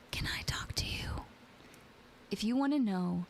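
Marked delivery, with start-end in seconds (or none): 0.1-1.2 breathy imitated whisper of the stranger; creepy whisper. Narrator imitating a stranger at her window, creepy whisper. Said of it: "he whispered".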